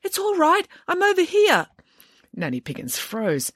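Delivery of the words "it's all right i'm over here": put-on high girlish call; high, carrying reassurance. A narrator's reading. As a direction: call across to be found. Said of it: "cried a voice".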